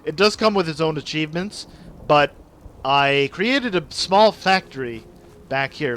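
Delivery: usual speaking voice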